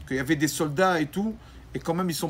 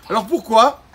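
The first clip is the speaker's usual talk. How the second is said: loud pressing question; 5 semitones higher, 10 dB louder than usual. Acted. Rabbi pressing the mother.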